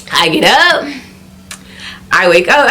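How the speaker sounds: normal talking voice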